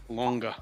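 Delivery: "Longa" with a hard g and an added a sound; Long Island pronunciation. Shown as a demonstration. Showing friends the Long Island "Longa", said softly. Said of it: "There's an A on the end of long".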